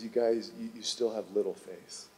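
soft gentle chiding. Acted. Jesus gently chides disciples.

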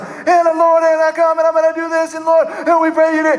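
high, shouted chant held on one pitch; fired-up Pentecostal prayer. Acted. Preacher making fun of Pentecostal praying, fired-up high shouted prayer. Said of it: "you get all fired up".

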